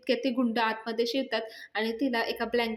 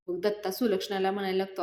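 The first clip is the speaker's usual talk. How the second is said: normal talking voice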